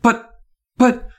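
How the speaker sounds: high, halting stammer. A narrator's reading. Stunned Miss Carmen finding no words, stammering. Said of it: "she stammered".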